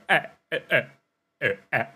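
high, tight choking sounds as the throat closes up; choked-up strangled eh. Shown as a demonstration. Showing viewers how her throat closes when she cries, strangled choking sounds. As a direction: catch in the throat, the words won't come out. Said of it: "my throat closes and I just go".

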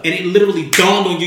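ordinary talk, nothing marked

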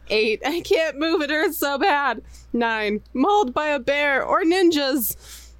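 high, mock-desperate pain; hammed-up agony. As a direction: play pain climbing towards unbearable, drawn out at the end. Acting the top of the pain scale.

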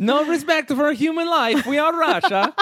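high mock Borat accent, laughing through it; Borat voice Russian bit. Still doing Russia for her friend, giggling Borat voice. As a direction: boast like a hammy Borat-style Russian. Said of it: "give me Russian".

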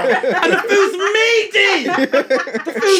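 high, loud, stretched-out mock complaint; laughing, hammed-up phone rant; mock screaming. Friend joins in imitating her phone call, mock screaming. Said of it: "She's screaming down the market".

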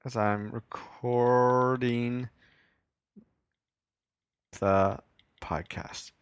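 very slow, careful read-aloud. Real, not acted. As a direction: drag each word as you read. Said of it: "I'm reading so slow there".

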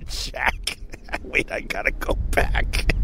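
whispered, to himself; whispered aside. Real, not acted. Aside, words unvoiced.

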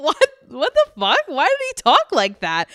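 high, loud, amused disbelief; baffled outburst. Retold or imitated. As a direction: blurt it out, incredulous and near laughing.

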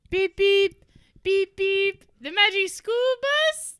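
loud, high honking beeps. Acted. Woman imitates the bus horn while telling her love story.

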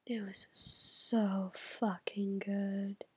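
slow breathy whisper of awe; whispered gushing. Real, not acted. Vlogger whispering her love of Outer Banks to camera. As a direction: hush it like a secret delight.

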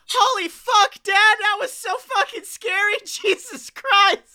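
high, loud thrilled yell; excited shrieking. Real, not acted. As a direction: yell in delighted fright. Reacting scared to the bit.

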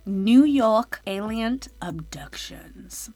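breathy spooky intro whisper. Acted. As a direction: hush it like a ghost story. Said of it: "let's get into our first story".

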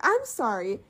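mock apology climbing high; sassy retort, rising into a high squeal. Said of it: "All right, sassy girl".

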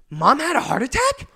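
high, loud, incredulous question; brother's shocked question. Retold or imitated. Brother on the phone learning about mom's heart attack, shocked. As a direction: imitate a brother blindsided by bad news.

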